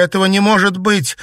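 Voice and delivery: high, loud, rising lament; distraught, escalating repetition; wailing denial. A narrator's reading. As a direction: wail it in disbelief. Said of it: "запричитала Наташа".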